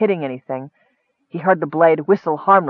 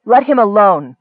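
louder, higher, sharp protest; angry retort; 5 semitones higher, 7 dB louder than usual. A narrator's reading. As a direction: cut in angrily to defend him. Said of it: "Nicole retorted angrily".

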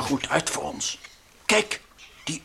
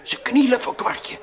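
higher, louder amazed report; excited; 11 semitones higher, 5 dB louder than usual. Acted. Frans amazed that natives kneel to Kwartje. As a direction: announce it in disbelief.

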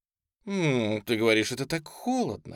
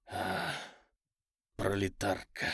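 приглушённый хрип страсти; прохрипеть тихо, с паузой; хрипло; 3 semitones lower, 6 dB quieter, slower than usual. A narrator's reading. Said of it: "прохрипел он". (сдавленный низкий выдох)

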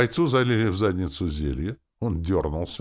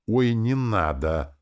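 slow, louder groan of refusal; wincing distaste; 6 semitones higher, 5 dB louder, slower than usual. A narrator's reading. Lord waves away the unwanted oath, wincing. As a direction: draw it out with a wince. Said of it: "скривился я".

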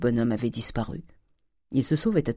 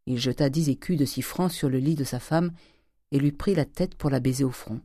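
plain reading voice

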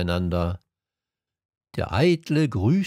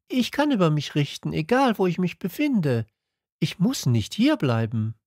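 higher, quick voice of the little prince; 9 semitones higher, faster than usual. A narrator's reading. The little prince tells the king he can leave, higher voice. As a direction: play the little prince, light and high, hurrying.

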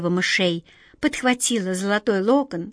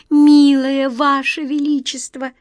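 high, loud, slow trembling plea; 5 semitones higher, 6 dB louder, slower than usual. A narrator's reading. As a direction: beg with a shaking voice. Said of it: "дрожащим голосом сказала Ниточка".

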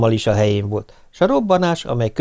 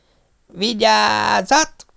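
very slow, high warning with a rise; long drawn-out yell; 10 semitones higher, much slower than usual. Acted. Pop bellowing the last warning, drawn-out yell. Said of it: "üvöltötte a tűzmester".

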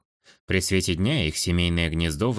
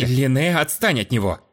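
indignant; 5 semitones higher, 4 dB louder than usual. A narrator's reading. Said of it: "возмутился Каэт".

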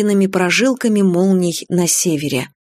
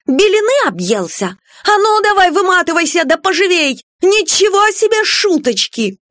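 high-pitched outraged tirade; indignant scolding; 8 semitones higher than usual. A narrator's reading. Salon owner throwing Grady out of her beauty salon, indignant scolding. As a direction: scold the intruder and drive him out.

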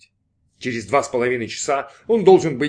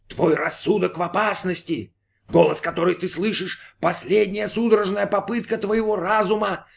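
warning inner voice; 7 semitones higher than usual. Acted. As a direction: warn him gravely in a high voice. Inner voice warns Brin.

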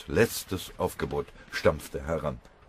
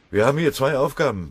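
high, loud matter-of-fact report; brisk military report; 9 semitones higher, 8 dB louder than usual. A narrator's reading. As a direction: report loudly to a fellow soldier. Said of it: "berichtete er kurz und sachlich". (kurze, sachliche Meldung mit lauter Stimme)

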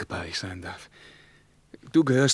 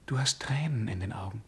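hushed whisper; 6 dB quieter than usual. Acted. Tearful intimate exchange.

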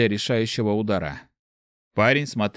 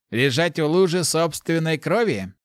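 higher, harsh mage question; 6 semitones higher than usual. A narrator's reading. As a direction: drive the point home. Mage challenges the boy.

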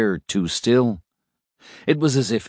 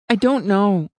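tearful; 3 dB louder than usual. A narrator's reading. Isabel crying, repeats.

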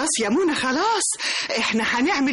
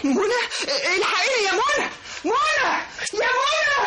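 rising, higher alarmed cries for help; panicked cry; 8 semitones higher, slower than usual. Acted. Nanny grabbed by strangers cries out to Mona in panic.

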